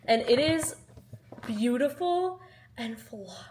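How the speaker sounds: ordinary talk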